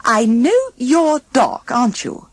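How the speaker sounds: put-on high, gentle young woman.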